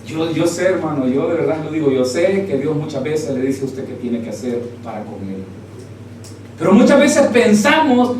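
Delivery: usual speaking voice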